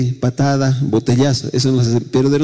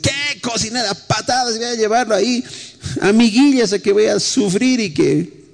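mock harsh parent; 7 semitones higher than usual. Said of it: "algunos papás deben decir".

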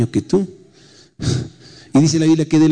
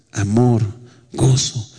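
ordinary talk

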